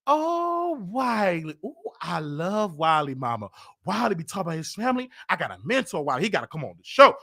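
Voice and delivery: soft, low, cooing put-on voice; mock sweet Tasha. Mocking Tasha gushing about him to her mama, soft coo. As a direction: drip fake affection, hushed.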